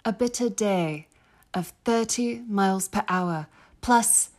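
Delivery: put-on amateur British accent veering Aussie; measured diary reading in British-Australian accent; British accent, slightly Australian. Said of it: "It got a little Australian".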